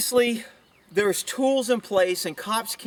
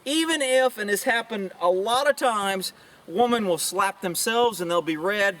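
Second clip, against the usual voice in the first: normal talking voice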